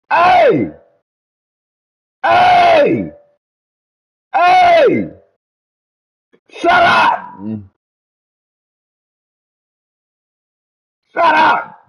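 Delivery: loud, high-pitched yells to silence someone; real shouting. Real, not acted. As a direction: shout over the noise to shut them up. Shouting down someone yelling nearby.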